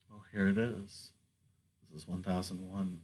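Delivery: soft and low, almost to yourself; hushed confiding. Acted. Poet hushed and close, confiding to the listener. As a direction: confide it quietly to one listener. Intimate quiet opening to a listener.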